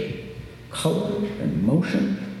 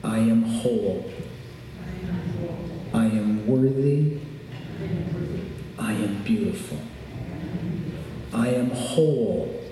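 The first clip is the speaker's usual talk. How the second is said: calm grounding exercise; 3 semitones lower, 5 dB quieter than usual. Shown as a demonstration. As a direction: guide them gently through breathing. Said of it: "end with a little exercise".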